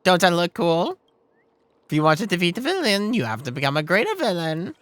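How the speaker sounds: loud, high, boastful villain; cartoon villain. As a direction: ham it up as a cartoon boss. Villain Boss Stone speaks.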